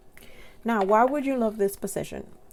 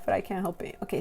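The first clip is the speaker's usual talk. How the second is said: usual speaking voice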